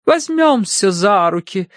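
high, slow command; команда медиума. A narrator's reading. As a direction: order the sitters as a medium. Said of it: "скомандовала она". (повелительно, высоко и медленно)

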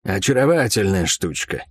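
ordinary delivery, nothing marked

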